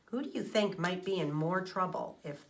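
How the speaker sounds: usual speaking voice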